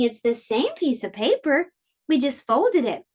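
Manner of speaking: plain reading voice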